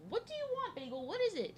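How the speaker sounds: high cooing questions to the cat; pet talk. Real, not acted. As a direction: owner cooing at her cat. Talking to her cat.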